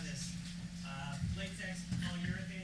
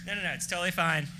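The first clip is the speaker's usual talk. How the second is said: normal talking voice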